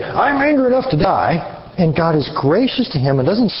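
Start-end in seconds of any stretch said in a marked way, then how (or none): 0.0-1.6 high, mocking whine of Jonah's line; hammed-up mockery of a sulky prophet. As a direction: sneer it back as the foolish Jonah. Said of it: "stupid enough to make the statement".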